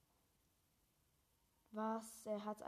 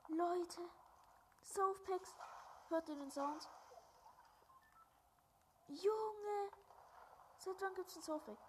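high, loud, thrilled outbursts; real excited gamer yelling; excited shouting; 12 semitones higher, 12 dB louder, slower than usual. Real, not acted. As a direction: yell it like you just found treasure. Finding softpacks back in game.

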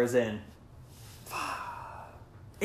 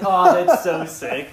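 excited; 4 semitones higher, 9 dB louder, slower than usual. Retold or imitated. Quoting own reaction to meme.

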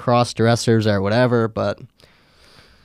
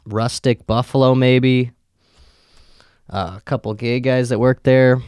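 ordinary talk

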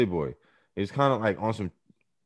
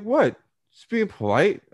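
high, slightly loud innocent protest; mock innocent friend; 12 semitones higher, 3 dB louder, slower than usual. Friend denying he's mouthing off to the cop, mock innocence. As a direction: play dumb and defensive. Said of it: "He's like".

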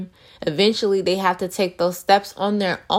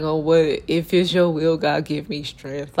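mock timid prayer; 2 semitones lower than usual. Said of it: "I don't like".

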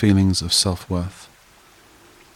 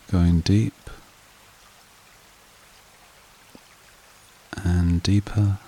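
guided meditation; 4 dB quieter than usual. Acted. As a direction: lull them evenly, softening as each phrase settles. Leads a breathing meditation.

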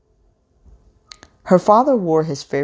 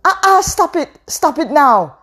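pleading shout; 14 semitones higher, 6 dB louder than usual. A narrator's reading. Mother stops husband hitting daughter.

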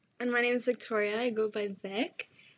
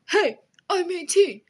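mock angry retort; 4 semitones higher, 7 dB louder than usual. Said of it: "He's like".

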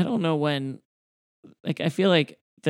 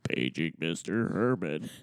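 much lower, slow, softer PA page; flat echoey PA page; 10 semitones lower, 7 dB quieter, slower than usual. Retold or imitated. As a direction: page like a hotel intercom. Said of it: "obviously not his voice".

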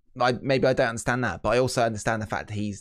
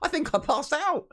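quoting Flair. Retold or imitated. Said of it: "You worded it to Andrade".

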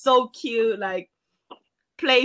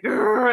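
imitated dog growl.

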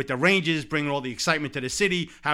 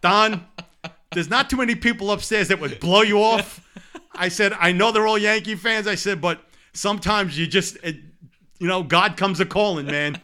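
excited retelling; 5 semitones higher, 5 dB louder than usual. Retold or imitated. Retelling his excuse to Mattingly.

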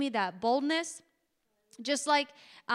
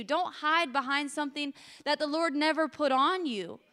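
normal talking voice

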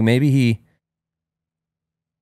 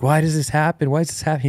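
put-on high, bewildered little-boy questions; a hint of a confused toddler; lightly voiced worried child; 6 semitones higher, faster than usual. And then he's like.

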